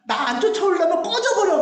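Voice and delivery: mock angry dismissal. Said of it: "막 이러면서".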